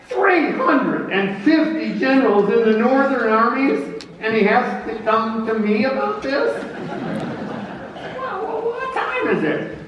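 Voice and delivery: high, put-upon general voice with a laugh; grumbling general. Acted. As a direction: grumble that it's unfair, then chuckle. Grant grumbling about Lee.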